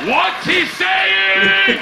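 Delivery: very slow, high, loud demand; insistent shout. Acted. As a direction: bellow it, stretching every word. Demands the same answer again, shouting.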